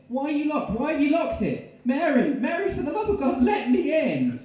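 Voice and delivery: very high, loud frantic pleading; desperate yelling. Acted. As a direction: bang on the door and scream to be let in. Knocking, locked out, begging Mary.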